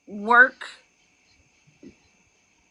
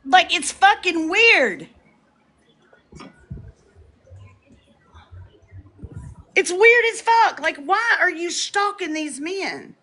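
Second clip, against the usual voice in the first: heated rant; 7 semitones higher than usual. Real, not acted.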